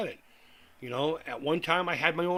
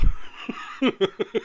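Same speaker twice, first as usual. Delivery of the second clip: breathy laugh; 8 semitones higher, 6 dB louder than usual. Real, not acted. Laughs telling them to look it up.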